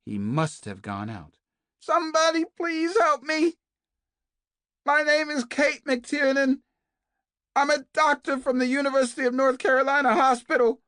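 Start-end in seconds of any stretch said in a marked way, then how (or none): 1.8-10.8 much higher and louder, screaming for help; desperate scream. A narrator's reading. Captive woman screams through the walls for help, full scream. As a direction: scream it at the top of your lungs. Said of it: "The screams continued".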